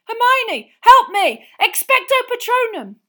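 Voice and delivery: high, full-force cry for help; desperate shout. A narrator's reading. Harry calling Hermione to help, shouting desperately. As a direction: scream for backup, frantic and high.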